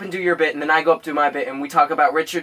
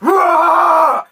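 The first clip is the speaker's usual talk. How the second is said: full-throated, high screaming roar; long, loud animal roar at full force; Wolverine; 13 semitones higher, 9 dB louder than usual. Retold or imitated. Comic acting like Wolverine in the hospital, screaming roar. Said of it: "I was fucking screaming".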